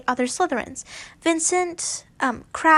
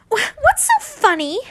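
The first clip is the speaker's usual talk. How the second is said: much higher, louder indignant snap; furious demand; 10 semitones higher, 5 dB louder than usual. Acted. Davis demanding why Draco laughs, high and furious. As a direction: demand it, offended. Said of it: "Davis looked furious".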